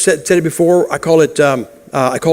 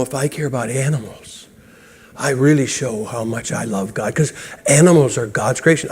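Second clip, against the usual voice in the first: lightly mocking, much softer pious voice; mocking animal lover; 4 semitones lower, 5 dB quieter than usual. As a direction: mimic a self-righteous animal lover, lower. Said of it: "Well, you know".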